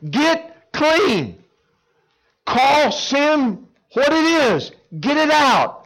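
fervent shouted exhortation; preaching climax. Acted. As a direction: hammer each command. Peak of preaching.

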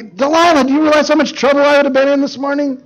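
high, rushed mock exasperation; exasperated protest. Samson argues back at Delilah, mock exasperation.

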